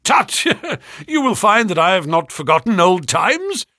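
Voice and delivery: loud, high forced cheer; cried out. A narrator's reading. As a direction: laugh it off loudly. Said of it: "cried Mister Trevor".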